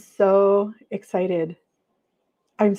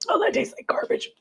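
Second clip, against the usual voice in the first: disgusted; 4 dB quieter than usual. Real, not acted. Tasting powder she dislikes.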